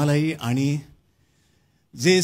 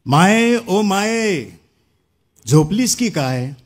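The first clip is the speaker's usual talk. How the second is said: raised, drawn-out call to mother; 2 semitones higher, 3 dB louder, much slower than usual. Acted. Poet calls to his mother language as if asleep, calling out. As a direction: call out as if to wake her.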